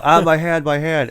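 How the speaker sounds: high, loud pained cry about the hand; hammed-up cry of pain; mock pained cry. Retelling the phone booth fight, mock pained cry. Retelling door slam scene.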